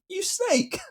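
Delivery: very high-pitched playful outcry; mock accusation. Patient retells rounding on the surgeon over the physio, mock accusing squeal. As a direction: squeal it in mock betrayal, laughing after.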